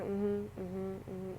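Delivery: soft, flat mm-hmms hiding tears; quiet mm-hmm. Retold or imitated. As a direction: murmur them to dodge talking. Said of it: "I was just going".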